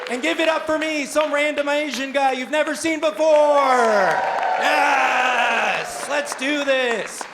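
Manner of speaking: loud, high shouted welcome; big high-energy stage opener; festival host hyping the crowd. Real, not acted. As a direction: shout to whip up applause. Hypes up the crowd.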